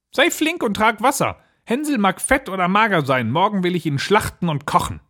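high-pitched, impatient witch; witch calling out. Acted. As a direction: hurry her along in a high witch voice. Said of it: "rief sie dem Mädchen zu". (ungeduldig rufende Hexe)

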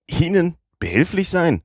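a shocked, high-pitched echo of his offer; indignant surprise. Acted. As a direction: throw the question back in disbelief. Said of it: "rief Passepartout".

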